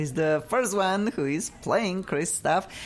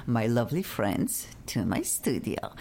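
usual speaking voice

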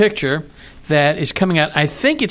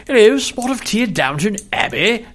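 higher-pitched, hammed-up upper-class English accent; princely English accent; 5 semitones higher than usual. Shown as a demonstration. Co-host contrasts it with Cockney, princely English accent. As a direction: put on a Downton Abbey English accent. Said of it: "but the ones that say".

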